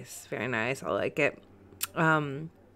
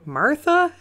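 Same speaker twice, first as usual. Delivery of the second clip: high, louder questioning rise; imitated puzzled caller; 11 semitones higher, 6 dB louder than usual. Host imitating callers mishearing [name], puzzled high question. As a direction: mimic a caller mishearing the name. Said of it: "they always say".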